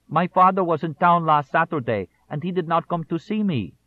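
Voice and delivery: put-on high, loud Mexican-American accent of a crying boy; a small Mexican-American boy's accent, tearful and high. A narrator's reading. Boy tells his friend in a Mexican-American accent why he cries. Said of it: "accent was that of a small Mexican-American boy".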